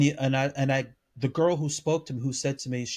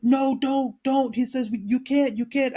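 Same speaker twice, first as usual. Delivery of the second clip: imitating frantic pleading; 8 semitones higher than usual. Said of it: "He was like".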